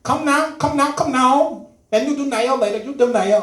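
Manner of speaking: high, loud mock mother; mom scolding. Mom ordering her son to clean his room, mock scolding. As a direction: nag slowly in the mother's accent. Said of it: "she'd tell me".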